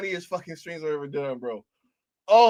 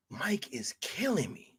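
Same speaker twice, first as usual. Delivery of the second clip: breathless whisper; 10 dB quieter than usual. Real, not acted. Streamer whispering that the reviewer is too funny. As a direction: whisper it, out of laughs.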